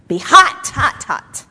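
high, loud burst on HOT; fired-up exhortation. Real, not acted. Preacher urging people out of spiritual chills, fired-up exhortation. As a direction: punch out each hot, high and loud at first.

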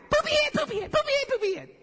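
put-on high, loud sing-back kid squeals; squabbling kids. Two kids trading a silly name in the car, high shrill-pitched shouting. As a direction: bounce it back and forth like shrieking children. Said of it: "it went back and forth".

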